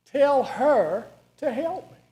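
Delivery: high, loud, slow command; Martha's order. Acted. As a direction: bark the order at the master. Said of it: "She gives him an order".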